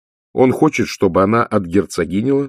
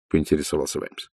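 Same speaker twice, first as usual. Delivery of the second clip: ordinary delivery, nothing marked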